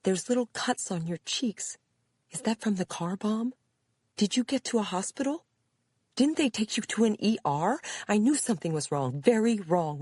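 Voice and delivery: high, fretful string of questions; worried mother. A narrator's reading. Mother questions daughter after car bomb, alarmed.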